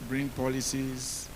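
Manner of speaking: ordinary delivery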